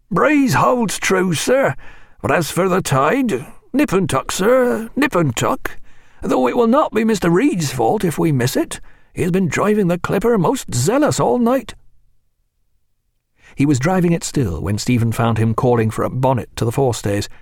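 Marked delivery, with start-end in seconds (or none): 0.1-11.8 put-on higher, rough old seaman voice; a salty old sailor, gruff. A narrator's reading. Seaman speaks.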